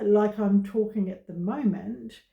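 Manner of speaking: usual speaking voice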